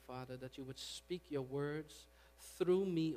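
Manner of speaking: ordinary talk, nothing marked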